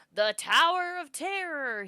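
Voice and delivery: slow, high, loud showman's flourish; grand announcement. Acted. Announcer presenting the tower to the children, with a slow, loud flourish. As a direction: unveil it like a showman. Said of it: "he announces with a flourish".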